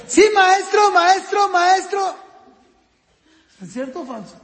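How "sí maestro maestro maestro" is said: high, loud pupil calling the teacher; eager pupil. Retold or imitated. Child on a home Zoom class calls teacher, high and insistent. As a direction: mimic a kid clamouring for the teacher's attention.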